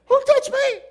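high, startled question; startled Jesus. Acted. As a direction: whip round in sudden surprise. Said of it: "That's how he said it".

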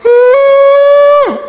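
very high, loud drawn-out "ooh"; high falling-to-the-knees howl; acted wail. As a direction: wail out one long high note while collapsing. Acts out collapsing to his knees.

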